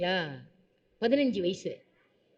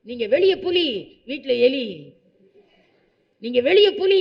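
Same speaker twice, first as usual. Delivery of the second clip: teasing loud consolation; 6 dB louder than usual. Retold or imitated. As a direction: tease her loudly like a cheeky child. Teasing mother, tiger outside. (உரத்த கேலியான ஆறுதல்)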